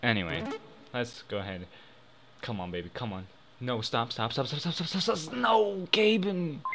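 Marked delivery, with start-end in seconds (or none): 3.6-6.6 stop, stop, stop, rising higher and higher in panic; begging the wheel to stop, climbing in pitch; real panic as the wheel slows, pleading stop; frantic pleading. Real, not acted. Roulette landing on wrong color.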